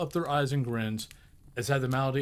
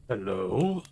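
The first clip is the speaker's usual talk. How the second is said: mock growl; 4 semitones lower than usual. A narrator's reading. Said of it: "growled Scrooge in his accustomed voice".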